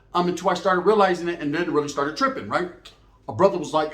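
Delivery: ordinary talk, nothing marked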